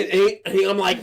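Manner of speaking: ordinary talk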